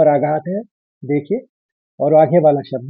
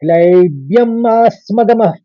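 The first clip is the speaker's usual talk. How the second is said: full shloka recitation; 3 semitones higher, 3 dB louder than usual. Shown as a demonstration. Teacher chanting the whole verse for students. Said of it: "अब पूरे श्लोक को एक साथ सुनिए".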